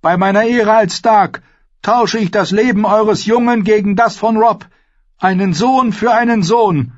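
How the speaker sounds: high, loud, urgent vow; desperate oath. A narrator's reading. Mother trades hostage for her son, desperate vow. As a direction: press the bargain hard.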